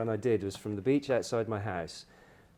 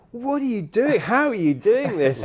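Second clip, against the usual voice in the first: high and louder, calling over the wind; amazed shout; 12 semitones higher, 11 dB louder than usual. Retold or imitated. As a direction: yell in amazement from a kite passing close by.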